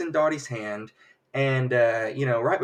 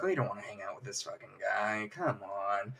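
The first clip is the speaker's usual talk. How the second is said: low, much softer mock grumble; grumbling aside; 5 semitones lower, 11 dB quieter than usual. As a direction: complain under your breath to a buddy. Guys grumbling about unwanted newcomer.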